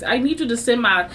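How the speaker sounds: ordinary delivery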